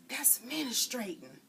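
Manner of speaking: slow secret whisper. Acted. Frida whispers the word to the girls. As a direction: hush it like a forbidden word.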